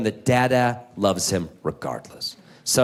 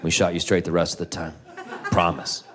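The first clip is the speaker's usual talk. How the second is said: soothing parent; 8 semitones lower, 5 dB quieter, faster than usual. Retold or imitated. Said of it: "We're like".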